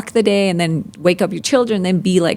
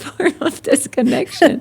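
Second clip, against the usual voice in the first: usual speaking voice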